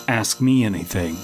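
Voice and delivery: ordinary talk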